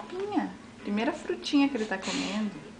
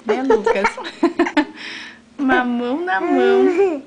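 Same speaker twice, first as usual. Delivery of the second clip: laughing; 5 semitones higher, 10 dB louder than usual. Real, not acted. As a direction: laugh through the words. Laughing while feeding baby.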